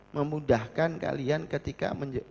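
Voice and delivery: ordinary talk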